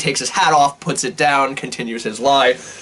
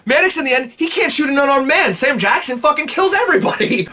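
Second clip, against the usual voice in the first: excited rise; 10 semitones higher than usual. Real, not acted. Friend gushing about the film's twist, excited high. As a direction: voice jumps up high in disbelief.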